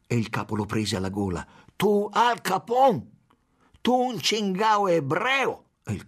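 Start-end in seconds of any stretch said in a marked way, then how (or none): 1.8-5.7 much higher, louder, slow insults; furious slow shout. A narrator's reading. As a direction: spit each insult out. Chief throttling and insulting consul.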